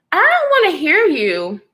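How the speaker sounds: acted-out exasperation; fed-up dismissal. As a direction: shut him down, as a retelling. Retold dismissal of the man.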